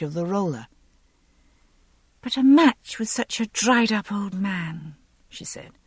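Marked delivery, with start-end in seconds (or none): 2.2-5.0 put-on higher-pitched, coaxing young noblewoman; winsome young lady. A narrator's reading. Young lady coaxing the groom about her match, winsome. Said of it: "Assuming a more winsome expression".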